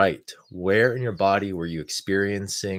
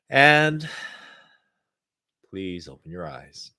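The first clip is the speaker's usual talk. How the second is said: calm, unhurried guidance with long pauses. Acted. Leads a guided meditation.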